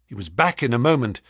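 ordinary delivery, nothing marked

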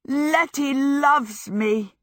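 high, drawn-out elated announcement; joyful shout. A narrator's reading. Michael announces his love while dancing Sophie round, joyful shout. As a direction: proclaim it slowly, overjoyed. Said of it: "Michael shouted".

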